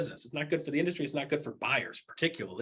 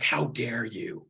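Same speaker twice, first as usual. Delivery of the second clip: mock outraged customer; 5 semitones lower than usual. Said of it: "And like".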